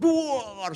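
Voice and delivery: high boom, slow and drawn out; crashing boom. Retold or imitated. Preacher acting out the second window breaking, drawn-out boom. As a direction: burst out a long shattering crash.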